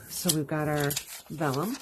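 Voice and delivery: usual speaking voice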